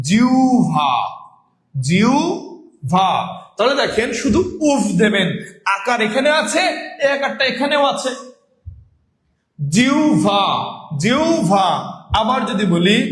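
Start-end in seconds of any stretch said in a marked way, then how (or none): 0.1-0.9 জিহ্বা said as "jiu-bha"; jiu-bha, no doubled b; correct pronunciation. Shown as a demonstration. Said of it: "উভ এর মতো হবে". 1.7-3.1 জিহ্বা drawn out as "jiuu... bha"; very slow teaching demo of "jiu-bha"; slow correct pronunciation. Shown as a demonstration. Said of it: "উচ্চারণটা কি হবে". 9.6-11.7 জিহ্বা said as "jiu-bha", twice; careful jiu-bha; correct pronunciation. Shown as a demonstration. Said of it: "উচ্চারণটা কি হবে".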